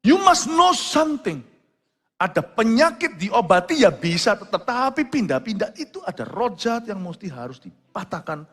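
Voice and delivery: fiery preacher proclaiming the healing to the congregation; preaching at full force. Acted. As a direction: drive the miracle home to the crowd. Preaching climax.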